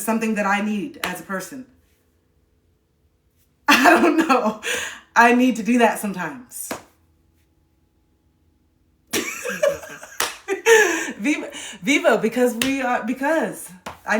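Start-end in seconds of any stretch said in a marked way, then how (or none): 3.0-4.6 slow, loud laugh through the words. Real, not acted. To her live viewers after acting weird, real laugh. 9.1-11.1 real laugh, much higher; high burst of laughter. Real, not acted. To viewers after her silly bit, high real laugh.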